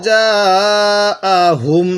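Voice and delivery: জাআহুম drawn out very slowly; slow recitation. Shown as a demonstration. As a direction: stretch the word like Quran reading. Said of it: "আইনটা একটু চাপ দিয়ে পড়ব". (ধীর তিলাওয়াত)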